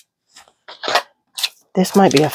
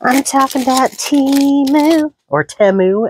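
high, loud ad announcer; Temu commercial voice; 10 semitones higher, 4 dB louder than usual. Retold or imitated. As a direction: belt it like a cheesy ad. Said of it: "as the commercials say".

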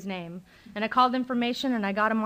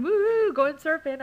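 mock surfer whoop; 7 semitones higher, 4 dB louder than usual. Friends honking and yelling from cars on the way surfing, whooping. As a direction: whoop it much higher and louder, carefree.

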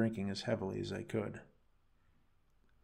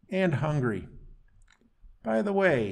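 high, loud taunting sneer; mocking; 6 semitones higher, 6 dB louder than usual. Creature mocks his chained captive, loud and high. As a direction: sing it out to needle him. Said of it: "He replied mockingly".

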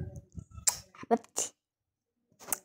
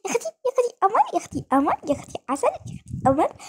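cooing; 8 semitones higher, much faster than usual. Real, not acted. Cooing over the baby.

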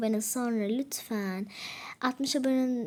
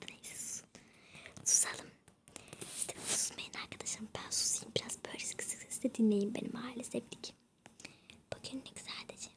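hushed whisper so dad stays asleep; late-night whisper into the mic; 13 dB quieter than usual. Real, not acted. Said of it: "O yüzden arkadaşlar çok bağırmıyorum".

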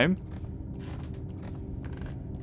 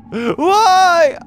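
startled cry; 11 semitones higher, 6 dB louder than usual. Real, not acted. Startled by shoe creature.

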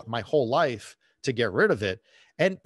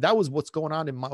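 ordinary talk

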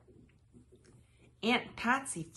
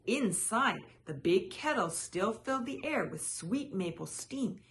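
ordinary delivery

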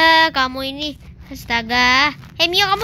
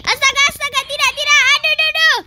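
high, frantic cries for help; panicked shriek; 8 semitones higher than usual. Acted. Panicking at Grandpa Butler to help them, high frantic cries. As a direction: squeal in panic as if burned. Panic during fire chaos.

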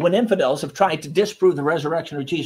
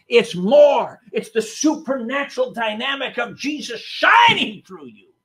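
rising, louder preaching build; 7 semitones higher, 6 dB louder than usual. Acted. Preacher driving home the point to the congregation, louder and higher. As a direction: preach it out with growing fire.

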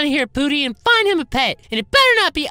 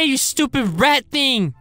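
ordinary talk, nothing marked

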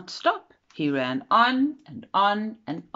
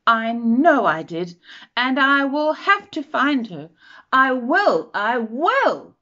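determined; 7 semitones higher, 8 dB louder than usual. Acted. Baby bird vows to find mother.

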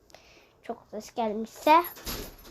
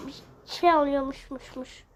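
ordinary talk, nothing marked

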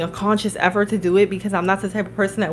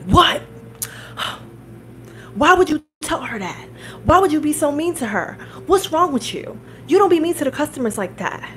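higher-pitched indignant telling-off; retold outrage, pitched up; imitated outraged scolding; 7 semitones higher than usual. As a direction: imitate an appalled boss scolding his worker. Imitating outraged owner scolding the worker.

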